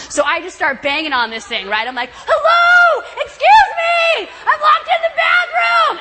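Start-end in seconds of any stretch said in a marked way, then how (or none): 2.1-6.0 very high, loud yell through a door; full-force call for rescue; slow, stretched-out desperate holler; shouting for help. Retold or imitated.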